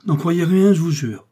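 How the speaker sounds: high, loud plea of innocence; urgent protest. A narrator's reading. D'Artagnan pleads his innocence.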